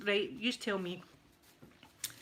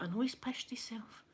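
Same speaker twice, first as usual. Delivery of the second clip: unvoiced whisper to daughter; 6 dB quieter than usual. Mother whispering to her daughter about the drunk man, hushed. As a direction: hush it behind your hand.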